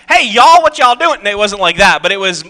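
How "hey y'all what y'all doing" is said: hammed-up hillbilly holler, high and loud. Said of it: "Hillbilly? Um, not like".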